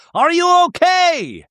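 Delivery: high, loud, drawn-out distant call; stretched high-pitched echoing cry; echoey voice. Retold or imitated. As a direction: call out slowly as if echoing underwater. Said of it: "you hear an echoey voice go".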